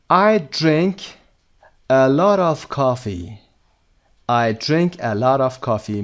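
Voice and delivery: very slow, carefully spaced words; slow model reading. Shown as a demonstration. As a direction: read it out slowly for learners. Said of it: "teacher reads answer slowly".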